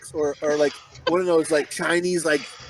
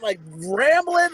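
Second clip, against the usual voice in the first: ordinary delivery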